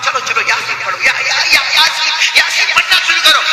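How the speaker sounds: rally them with growing urgency; urging the pupils. Retold or imitated. Teacher urges students to recite. (शागिर्दों को बढ़ते जोश से पुकारना)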